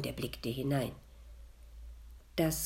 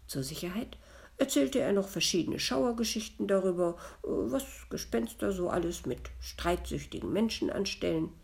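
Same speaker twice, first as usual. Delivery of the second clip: ordinary delivery, nothing marked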